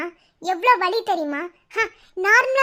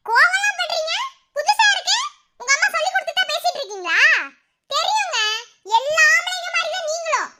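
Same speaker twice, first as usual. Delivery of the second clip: mocking; 8 semitones higher than usual. Wife mocking husband's anger.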